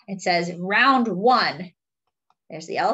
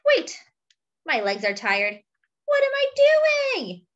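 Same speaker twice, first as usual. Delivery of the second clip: forgetful elephant. Acted. Fred the elephant agreeing with Monkey, lightly voiced and muddled.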